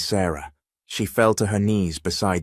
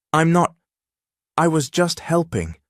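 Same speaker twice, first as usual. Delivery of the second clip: stammering; 9 semitones higher than usual. Acted. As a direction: blurt it out, stammering. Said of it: "Sarah stammered".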